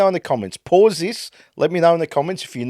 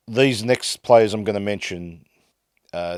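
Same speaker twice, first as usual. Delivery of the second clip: normal talking voice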